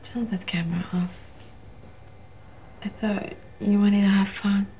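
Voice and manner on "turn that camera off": breathy whispered tease; woman luring him in; seductive whisper. Acted.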